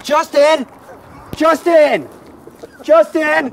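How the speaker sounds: high, loud mock holler; imitated high-pitched yelling for someone; calling out. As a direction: holler the name down the street. Imitating woman calling for Justin.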